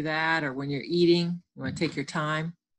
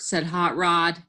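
normal talking voice

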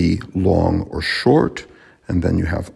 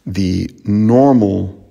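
ordinary talk, nothing marked

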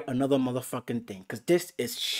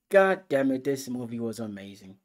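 usual speaking voice

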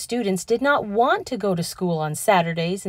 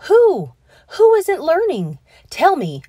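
alarmed, much higher and louder; urgent, anxious questions; worried; 10 semitones higher, 8 dB louder than usual. A narrator's reading. Worried principal pressing Tilly for a name, alarmed. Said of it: "He looked very worried".

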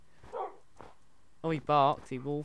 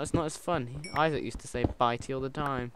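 ordinary delivery

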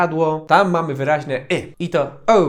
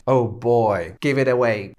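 put-on lower, recoiling oh boy; disgusted; 6 semitones lower, slower than usual. Shown as a demonstration. Someone handed something gross, lower and disgusted. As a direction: push the thing away in disgust. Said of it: "ktoś jest disgust".

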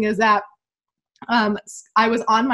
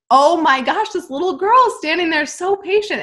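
amazed; 7 semitones higher, 5 dB louder than usual. Retold or imitated. Amazed at patient child.

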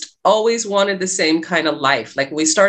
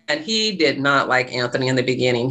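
ordinary talk, nothing marked

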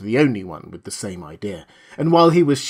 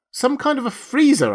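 high shriek without much volume; shrieked back; 12 semitones higher than usual. A narrator's reading. Wife answering husband over the uproar, mild shriek. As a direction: pitch it way up to be heard. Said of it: "she shrieked back unhelpfully".

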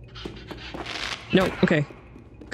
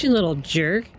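ordinary delivery

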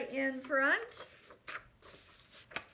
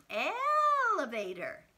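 slow drawn-out word; 4 semitones higher, 4 dB louder, slower than usual. Shown as a demonstration. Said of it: "What about".

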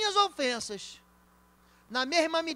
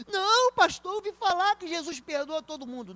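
mock congregant; 6 semitones higher, 5 dB louder than usual. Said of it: "Nananina não".

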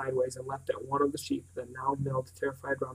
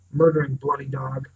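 louder furious outburst; fury; 8 dB louder than usual. A narrator's reading. Pritchard raging at the shot dog, furious and loud. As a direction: spit it out in rage. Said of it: "babbling with fury".

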